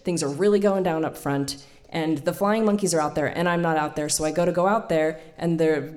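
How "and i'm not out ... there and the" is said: usual speaking voice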